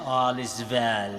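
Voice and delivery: Indian-English lilt on "All is well", the w turned to v as "vel"; Bombay accent. Retold or imitated. Priest quoting the Three Idiots catchphrase in a Bombay accent. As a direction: quote the film's catchphrase like its Bombay characters. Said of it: "kaya ganun yung intonation".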